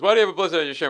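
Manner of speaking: loud, high-pitched mock demand; retold confrontation. Senior partner questions bloodstained lawyer, loud imitated demand. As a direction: press the question as an appalled boss.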